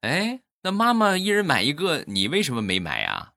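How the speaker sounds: put-on high-pitched child's question, falling back at the end; curious little daughter. Daughter asking mom about swim rings, imitated curious child voice. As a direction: imitate a puzzled little girl. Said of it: "我闺女眨了眨眼睛啊".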